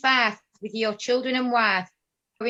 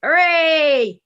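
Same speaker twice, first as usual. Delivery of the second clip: loud, higher victory cheer; 6 semitones higher, 7 dB louder than usual. Acted. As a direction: celebrate the win.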